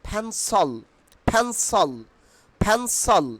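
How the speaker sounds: "ফ্যানসল" as phen-sol, puffed p; corrected pronunciation. Shown as a demonstration. Teacher drilling learners, "ফ্যানসল" with puffed p and sol ending. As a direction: aspirate the start of "ফ্যানসল", end on sol. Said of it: "পেন্সিলকে আমরা কি বলবো".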